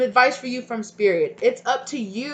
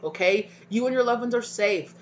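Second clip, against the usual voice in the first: ordinary delivery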